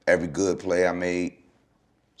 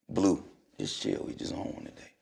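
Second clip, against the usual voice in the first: softer still, trailing into a whisper; near whisper; 3 semitones lower, 11 dB quieter than usual. Retold or imitated. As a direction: teammate calming Blue, whispered aside. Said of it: "Eric Snow".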